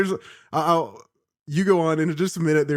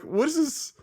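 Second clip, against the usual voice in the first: puzzled high voice; 12 semitones higher, 4 dB quieter than usual. Retold or imitated. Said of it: "it was also like".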